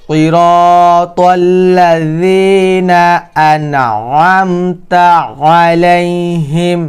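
slow, drawn-out verse recitation; Quran recitation. Shown as a demonstration. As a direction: recite slowly, stretching the long vowels and the final syllable.

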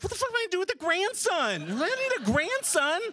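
high, fast, incredulous outburst; exasperated disbelief. Retold or imitated. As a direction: squeak out the outrage at the idea. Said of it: "And I'm like".

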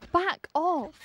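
high-pitched warding off; alarmed yelp. Real, not acted. Player chased by a stupid boar, alarmed yelp. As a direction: squeal it at an attacker.

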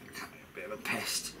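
sighing, half-whispered, fed up with the job; fed-up mutter. Real, not acted. To himself while refitting the carburettor, a quiet fed-up mutter. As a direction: grumble it quietly to yourself, worn out. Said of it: "I'm a bit fed up with this now".

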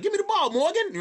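high, loud mock movie-star voice; Denzel-style call for the ball. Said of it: "the joke was worked out better the way I said it".

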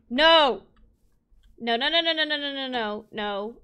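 shout, then stammer out a run of nos; alarmed shouting. Real, not acted. Player watching cows escape, alarmed shouting.

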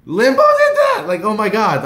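high, loud starstruck shouting; freaking out. Retold or imitated. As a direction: shriek it, then tumble down breathlessly. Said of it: "I was freaking out".

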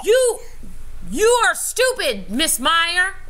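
hammed-up rude kid yelling; imitated student yell. Teacher retelling a rude student who yells at her, a high mock yell. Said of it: "yells at the teacher and says".

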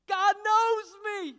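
high, slow, loud declaration; joyful exclamation. Acted. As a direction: lift it up like good news. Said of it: "and I go".

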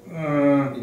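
high, drawn-out hum; Mike Jacobstein. Retold or imitated. Interviewee mimics his old mentor's greeting, high drawn-out hum. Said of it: "he speaks like that".

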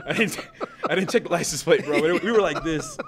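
real laughter through the words; high, loud, cracking up while talking; laughing. Real, not acted. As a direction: talk through your own laugh. Laughs at his own joke.

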